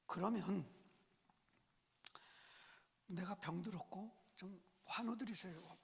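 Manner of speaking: ordinary talk, nothing marked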